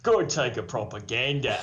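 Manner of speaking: broad, hammed-up Australian accent; mock Australian accent. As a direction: play a caricature Australian. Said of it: "like, an Australian accent".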